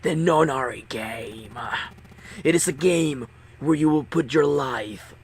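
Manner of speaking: slow, cold masked captain; implacable villain. Acted. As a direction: lay out the game coldly. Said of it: "The voice continued, implacable".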